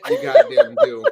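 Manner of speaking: shouted through laughter; laughing shout. Real, not acted. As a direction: yell it while cracking up.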